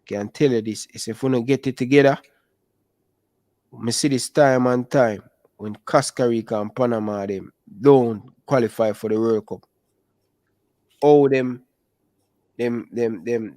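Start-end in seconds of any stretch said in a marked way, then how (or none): none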